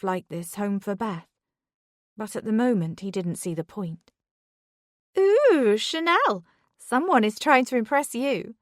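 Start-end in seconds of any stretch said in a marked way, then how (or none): 5.2-8.5 high-pitched, whiny coworker gushing. A narrator's reading. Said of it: "A high-pitched, whiny voice".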